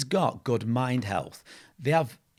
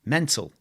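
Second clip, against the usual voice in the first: MENTAL said louder, higher, falling; harder-toned word; 4 semitones higher, 6 dB louder than usual. Shown as a demonstration. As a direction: hit MENTAL hard, pitch dropping away. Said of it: "but it's a softer, softer tone".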